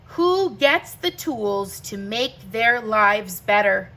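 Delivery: usual speaking voice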